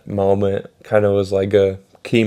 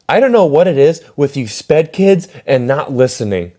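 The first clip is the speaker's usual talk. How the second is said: higher, mock-exasperated teacher voice; exasperated teacher scolding; 5 semitones higher, 4 dB louder than usual. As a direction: imitate a teacher berating the class. Teacher scolds pupils in retold quarrel.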